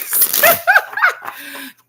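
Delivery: long, high, loud laughing fit; cackling laugh. Real, not acted. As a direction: crack up loudly. Laughing after bingo.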